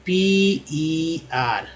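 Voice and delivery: "पी ई आर" spelled out letter by letter, drawn out slowly. Shown as a demonstration. Teacher spelling a word slowly for students. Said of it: "पर में ई जो है".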